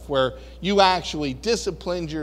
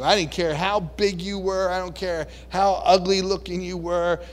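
ordinary delivery

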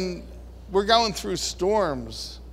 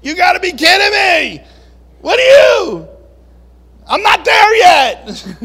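much higher, much louder outraged yell; hammed-up angry outburst; furious shout; 12 semitones higher, 13 dB louder than usual. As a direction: blow up in disbelief. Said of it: "Man, I'm like".

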